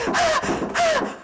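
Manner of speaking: very high shrieked ah; hammed-up high scream; mock scream. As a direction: shriek like a grown man terrified of a spider. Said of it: "they're going".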